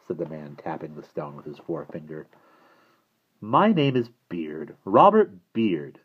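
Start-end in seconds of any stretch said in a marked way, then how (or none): 3.4-6.0 slow formal introduction. A narrator's reading. Stranger introduces himself grandly.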